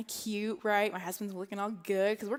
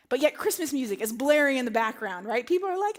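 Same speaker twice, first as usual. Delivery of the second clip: ordinary talk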